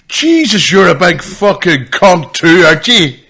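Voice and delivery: high, loud, slow challenge; brash Irish boy. Retold or imitated. Irish boy accosting a big comedian, loud brash challenge. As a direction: belt it out as the cheeky Irish lad. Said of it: "An Irish boy comes up and says".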